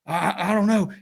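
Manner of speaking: mock panic. Woman tells store detective she's clueless, mock panic. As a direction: imitate someone panicking and stammering.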